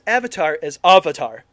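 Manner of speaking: "Avatar" said normally, then "Avatar" as AH-vatar; contrasted pronunciations. Shown as a demonstration. Host showing how the film says "Avatar", second one AH-vatar. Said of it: "nobody pronounces any, everything important".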